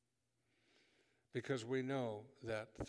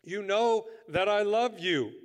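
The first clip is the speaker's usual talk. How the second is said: earnest avowal; 9 semitones higher, 17 dB louder than usual. Acted. Peter's earnest answer to Jesus.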